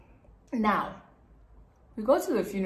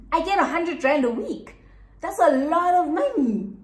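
indignant; 7 semitones higher, 5 dB louder than usual. Real, not acted. Indignant rant about stolen money.

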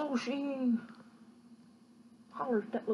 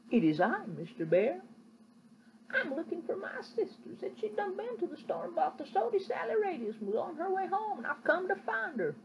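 put-on higher, earnest boy voice; boy answering the bear; little boy; 8 semitones higher than usual. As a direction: speak up like a brave little boy.

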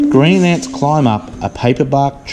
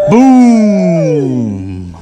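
booming thunder word, higher and louder; big storybook boom; 5 semitones higher, 4 dB louder than usual. A narrator's reading.

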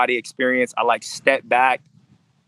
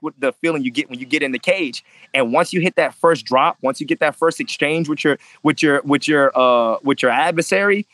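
usual speaking voice

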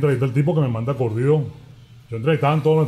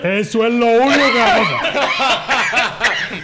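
laughing punchline; 5 semitones higher, slower than usual. Real, not acted. Punchline of coach story with laughter.

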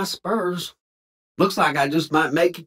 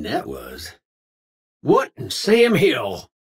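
put-on folksy Old West cowpoke voice, drawled twang; old cowboy. Game cowboy barking lines at strangers and outlaws, folksy twang. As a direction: play a grizzled frontier cowboy talking.

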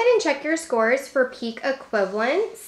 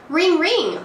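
high, lilting phone ring; ringing imitation; 6 semitones higher, 4 dB louder than usual. Shown as a demonstration. Teacher asks what makes this sound, ringing imitation. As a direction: ring out like a telephone. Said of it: "What makes that sound".

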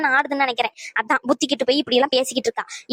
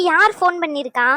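usual speaking voice

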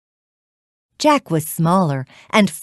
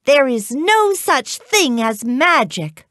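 higher-pitched scolding, mildly shouted; 7 semitones higher than usual. A narrator's reading. Mother snaps back at Jack, mildly shouting. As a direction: scold like an exasperated storybook mother. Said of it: "His mother shouted".

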